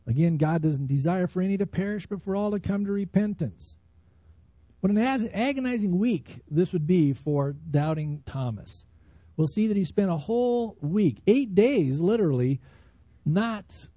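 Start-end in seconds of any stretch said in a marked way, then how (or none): none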